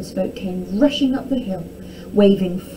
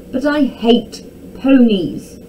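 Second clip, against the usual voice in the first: louder, slow, drawn-out complaint; protesting cry; 4 semitones higher, 6 dB louder, slower than usual. A narrator's reading. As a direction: wail mildly like a fed-up boy. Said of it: "cried Tom".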